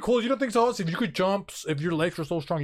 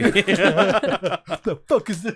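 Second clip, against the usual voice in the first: laughing disbelief; 3 semitones higher, 6 dB louder, much slower than usual. Retold or imitated. Bystander reacting, laughing.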